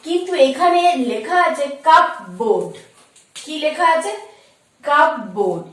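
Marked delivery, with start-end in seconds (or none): 1.9-2.7 কাপবোর্ড with a sounded p and a full "board"; wrong pronunciation. Shown as a demonstration. Teacher reading out what is written, wrong pronunciation. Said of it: "কিন্তু এটা কিন্তু wrong". 4.9-5.6 কাপবোর্ড with a sounded p and a full "board"; wrong pronunciation. Shown as a demonstration. Teacher reading out what is written, wrong pronunciation. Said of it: "কিন্তু এটা কিন্তু wrong".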